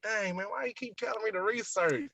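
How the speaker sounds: high-pitched retold complaint; puzzled, put-upon protest; exasperated. Retold complaint at repeated advice.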